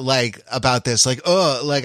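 ordinary talk

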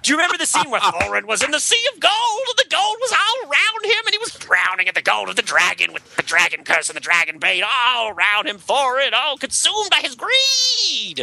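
high, screeching metal singer narration; howling metal storyteller voice; heavy metal screechy voice. Acted. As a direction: belt it like a metal frontman wailing an epic. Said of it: "heavy metal screechy voice".

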